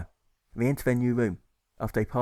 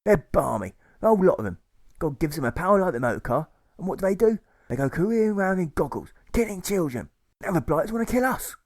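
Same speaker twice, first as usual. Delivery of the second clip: high, loud furious tirade; angry rant; 8 semitones higher, 4 dB louder than usual. Acted. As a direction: rail against the madmen. Socialist ranting in character.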